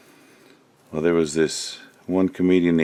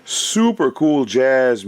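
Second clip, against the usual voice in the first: higher, louder, slow delight; excited praise; 7 semitones higher, 5 dB louder, slower than usual. Real, not acted. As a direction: relish each word, thrilled. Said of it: "praising the alarm".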